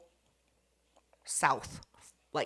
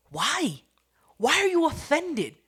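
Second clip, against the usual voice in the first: mock disbelief; 9 semitones higher, 6 dB louder than usual. Mocking the girl's reaction.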